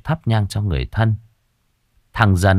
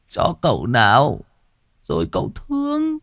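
put-on drunken coaxing; 4 semitones higher than usual. A narrator's reading. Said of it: "lè nhà lè nhè".

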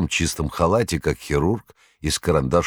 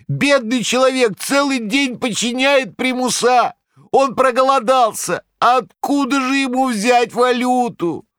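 very high, loud trembling lament; quavering plea; 4 dB louder than usual. A narrator's reading. Korovyev appealing to the crowd, high trembling plea. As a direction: wail for pity, slowing on the last words. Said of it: "подпустил дрожи в свой голос".

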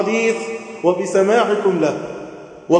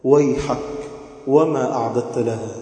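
ordinary talk

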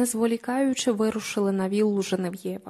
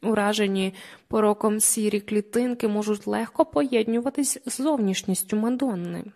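plain reading voice

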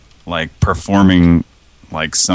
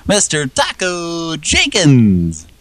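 high, slow showman announcement; announcer voice; 11 semitones higher, slower than usual. Pretend. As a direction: announce him like a game show host. Announcing a name like a host.

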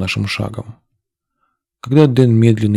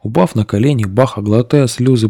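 ordinary delivery, nothing marked

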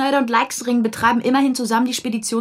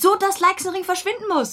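much higher, eager and emphatic; triumphant insistence; 12 semitones higher than usual. Acted. Colleague arguing the affair theory, high and triumphant. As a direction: clinch the argument with glee. Heated argument over the theory.

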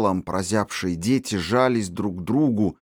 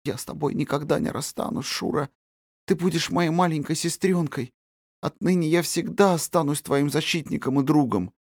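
resolute; 8 semitones higher than usual. Acted. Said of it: "решительно проговорил мальчик".

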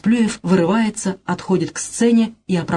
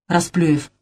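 ordinary talk, nothing marked

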